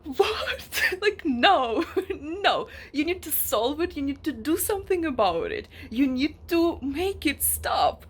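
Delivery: high shocked outburst, settling into insistent pleading; incredulous protest. Retold or imitated. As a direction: demand action through exasperated laughter. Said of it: "I'm just like".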